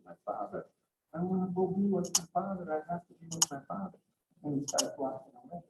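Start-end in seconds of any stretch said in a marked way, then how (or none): 0.1-4.0 tag. Acted. Nanabuji longs to join his father.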